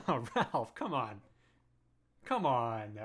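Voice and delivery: ordinary talk, nothing marked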